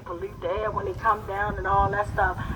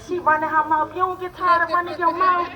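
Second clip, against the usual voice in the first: higher teasing grandmother voice; a hint of a nagging grandmother; scolding grandmother; 7 semitones higher than usual. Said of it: "my grandmother said".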